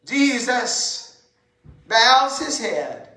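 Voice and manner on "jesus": ordinary delivery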